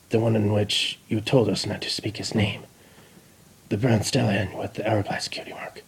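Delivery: soft, whispered warning; fearful hush. Acted. The spy whispering the forbidden name to his queen. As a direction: breathe the forbidden words.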